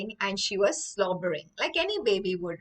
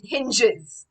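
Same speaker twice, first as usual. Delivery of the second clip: normal talking voice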